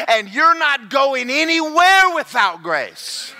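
loud, high, rising sermon shout; full-force preaching. Acted. Pastor shouting the sermon at full force. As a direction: preach it to the rafters.

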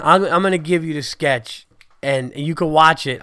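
usual speaking voice

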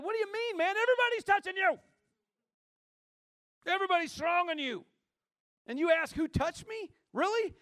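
mock the question in a high, exasperated pitch; incredulous disciples. Disciples protesting to Jesus, high and incredulous. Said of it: "his disciples said".

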